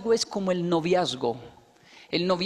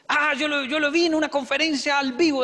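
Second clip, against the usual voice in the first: eager fan; 7 semitones higher, 4 dB louder than usual. Retold or imitated.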